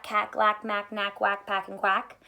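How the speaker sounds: plain reading voice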